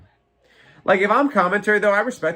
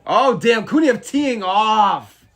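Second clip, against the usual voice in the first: hyped yell, higher and louder; excited; 7 semitones higher, 7 dB louder, much slower than usual. Real, not acted. Streamer watching Kuniev tee off, excited yell. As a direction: cry it out watching the barrage. Live fight reaction.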